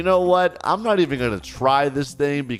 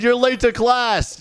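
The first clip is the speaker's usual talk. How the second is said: high-pitched mock scolding; yelling teacher; 9 semitones higher than usual. Strict teacher hurrying late student to class, mock yelling. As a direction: order the student off, voice raised in pitch. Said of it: "Bro just yells the whole time".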